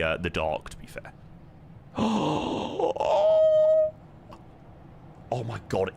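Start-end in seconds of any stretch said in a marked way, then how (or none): 1.9-3.9 sharp, high gasp of delight; a loud thrilled gasp; excited gasp. Real, not acted. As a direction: gasp in awe at the view. Reacting to cabin lights in dark.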